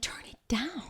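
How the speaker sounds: breathy, half-asleep whisper; sleepy whisper. Retold or imitated. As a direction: murmur it like you're drifting off. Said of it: "I'm like".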